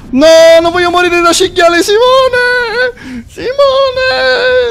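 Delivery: very high wailing cry of Simone; hammed-up wail. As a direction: wail as a doomed boar. Boar character fears dying. (lamento esagerato e acuto)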